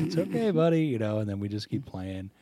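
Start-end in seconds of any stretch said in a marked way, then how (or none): 0.1-0.8 soothing dad. Retold or imitated. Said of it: "He's like".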